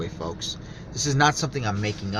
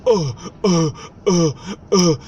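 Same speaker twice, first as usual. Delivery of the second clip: louder, higher mock stammer; lightly mocked stammering man; 5 semitones higher, 6 dB louder than usual. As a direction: play the flustered stranger caught out. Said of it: "And he goes".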